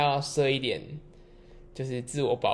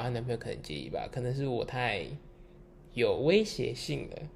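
ordinary talk, nothing marked

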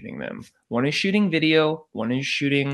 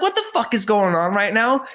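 high-pitched, bewildered outburst; retold frantic disbelief; overstimulated; 7 semitones higher, 4 dB louder than usual. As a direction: blurt it out, pitched up, overwhelmed. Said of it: "overly stimulated with what's going on".

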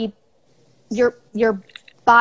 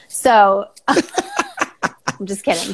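laughing; much slower than usual. Real, not acted. Takes back the chair joke.

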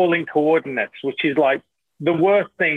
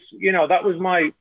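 normal talking voice

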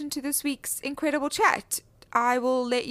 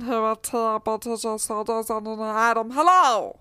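stiff robot voice, loud hello; 4 dB louder than usual. Acted. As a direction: announce it like a robot. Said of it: "what was the robot voice?".

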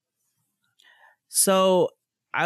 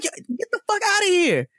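furious retold yell; 13 semitones higher, faster than usual. Yelling at the man who grabbed her arm, much higher furious yell. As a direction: tear into him for grabbing you.

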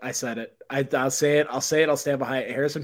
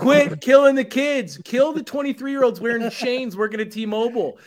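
mock outraged rant; 8 semitones higher, 6 dB louder than usual. Ranting about phone store clerks, hammed-up high loud outrage.

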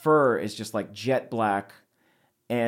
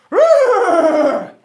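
big strong beast roar; creature roar; 12 dB louder than usual. Acted. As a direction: let out a huge high roar. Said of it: "lets out a big, strong".

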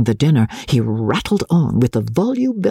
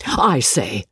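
usual speaking voice

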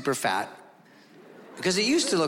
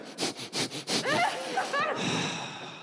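long, high breathy sigh; dog's long sigh; 4 dB quieter than usual. Retold or imitated. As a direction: sigh like a weary fat dog. Dog sighs at the food.

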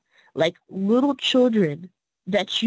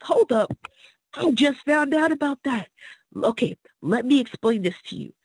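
excited realization; 6 semitones higher than usual. Retold or imitated. Retells own eager reply to members.

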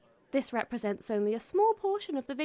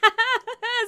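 high giggle; 10 semitones higher, 8 dB louder than usual. Acted. Laughing at the meme.

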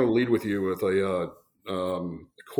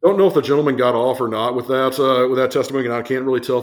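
laughing; 5 semitones higher, 10 dB louder, faster than usual. Real, not acted. Laughing after the punchline.

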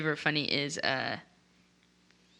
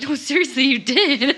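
louder, higher insisting; a hint of a husband insisting; imitating David; 8 semitones higher, 6 dB louder than usual. Mock husband insisting she really said it, raised and louder. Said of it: "He's like".

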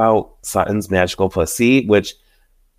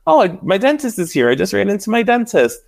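much higher, excited imitation of Erica offscreen; high mock exclaim; 10 semitones higher than usual. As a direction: pitch it way up like breathless gossip. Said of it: "you just hear her saying".

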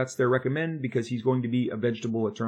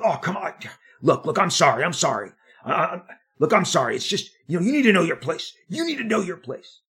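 high, loud grudging sorry from the editor; mock apology; 6 semitones higher, 5 dB louder than usual. Editor half-apologising then telling him to know his place, high and loud. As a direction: brush off the tears with a pushy sorry. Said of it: "He goes".

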